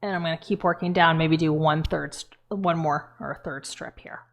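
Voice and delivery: talking with a thumb in the cheek; muffled, fingers hooked in mouth; muffled by hand in mouth. Real, not acted. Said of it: "it's hard to talk with my hand on my mouth".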